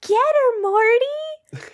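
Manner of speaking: high, loud hag command; hag shouting. Acted. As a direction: screech an order at the dog. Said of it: "the blind hag is like".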